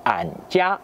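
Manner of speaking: an jia with an in place of wo; Henan dialect word. Shown as a demonstration. Teacher showing French viewers the Henan form an jia. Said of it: "ils emploient le mot an à la place de wo".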